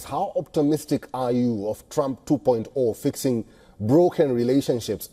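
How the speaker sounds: ordinary talk, nothing marked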